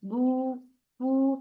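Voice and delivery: "बू, पू" with lips pushed round into a clear u. Shown as a demonstration. Teacher shows the class the pesh vowel: lips rounded on "बू, पू". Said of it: "पेश के लिए होठों को गोल करना है".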